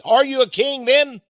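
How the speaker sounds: a hint of a stern Roman governor; Pilate's question. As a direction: press him like a suspicious judge. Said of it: "Pilate said".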